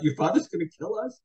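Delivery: retold panic. Young man to girl about her father, mock panicked. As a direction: act out startled dread, pitch leaping up.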